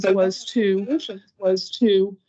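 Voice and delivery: words echo back on the call; doubled echo on the line. Real, not acted. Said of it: "I'm sorry, I'm echoing".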